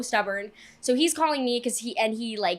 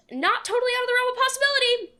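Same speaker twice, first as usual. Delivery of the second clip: emphatic admission; 13 semitones higher, 4 dB louder, faster than usual. Real, not acted. Admitting she believed it.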